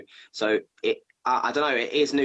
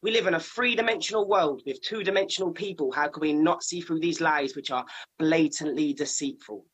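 poem recital; 6 semitones higher than usual. Acted. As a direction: read it like a poet on stage. Recites his spoken word poem.

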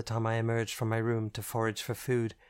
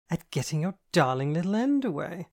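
higher, louder, suggestive; cheeky innuendo; 6 semitones higher, 4 dB louder than usual. A narrator's reading. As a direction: tease her wickedly. Andy's suggestive teasing.

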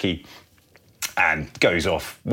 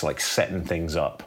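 ordinary talk

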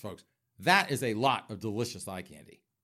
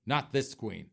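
usual speaking voice

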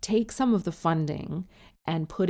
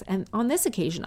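ordinary talk

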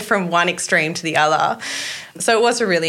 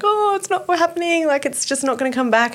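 1 despairing wail; 12 semitones higher than usual. Retold or imitated. Retold despair after spotting.

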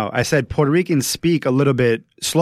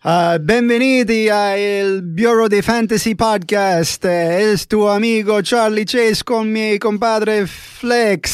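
slow, higher mock Italian announcer; Italian accent; 9 semitones higher, slower than usual. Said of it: "do an intro in Italian".

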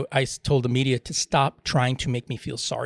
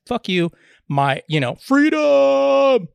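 drawn-out, much higher and louder mock cry of freedom; mocking defiance; 11 semitones higher, 6 dB louder, much slower than usual. Host mocks anti-maskers, stretching out a loud high mock freedom. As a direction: ham up a defiant protester yelling freedom.

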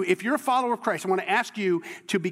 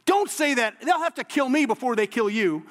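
higher, louder acted objection from Peter; vehement protest; 6 semitones higher, 3 dB louder than usual. Peter refusing Jesus' talk of dying, vehement protest. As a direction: protest loudly and urgently, pitched up. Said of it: "He's like".

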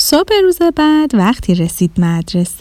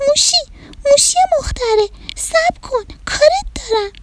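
very high, breathless bunny call; rabbit calling out; 4 dB quieter than usual. Acted. Rabbit chasing the mouse home, very high calling voice. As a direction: call after your friend in a tiny squeaky voice.

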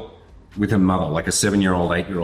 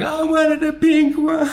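put-on very high, slow, wailing little girl; crying child; 4 dB louder, slower than usual. Little girl crying over the lost pink cast, very high wail. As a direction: wail it like a heartbroken kid. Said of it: "the girl is screaming and crying".

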